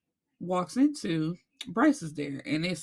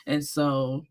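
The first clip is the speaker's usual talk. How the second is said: ordinary talk, nothing marked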